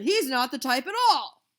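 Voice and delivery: loud dismissive bellow; shouting. A narrator's reading. The boss rejecting Murray, loud dismissive shout. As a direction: wave the kid away. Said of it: "he shouted".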